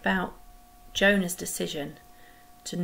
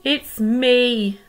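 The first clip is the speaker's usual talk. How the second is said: loud, higher groan of guilt; groaning confession; 5 semitones higher, 10 dB louder than usual. Acted. Jonah confessing to the sailors, groaning. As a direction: own up with a heavy groan. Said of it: "Jonah groaned".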